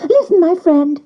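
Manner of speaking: high, chummy duck voice; wild duck voice. A narrator's reading. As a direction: lean in like a cheeky duck. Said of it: "they said".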